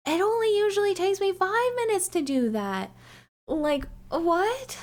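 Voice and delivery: high-pitched baffled protest; mock disbelief. Speaker reacting to ten minutes gone by, mock disbelief.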